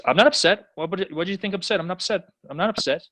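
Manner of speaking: high, protesting denial; a hint of a flustered Po; defensive panda. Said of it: "And Kung Fu Panda goes".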